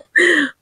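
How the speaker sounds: brief, high self-amused laugh; short laugh. Real, not acted. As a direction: laugh lightly at your own muddle. Rit de sa maladresse. (petit rire aigu)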